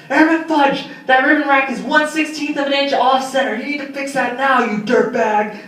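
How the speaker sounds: angry drill sergeant. Retold or imitated. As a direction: chew out the airman over a crooked ribbon rack. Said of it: "this angry sergeant start yelling at me".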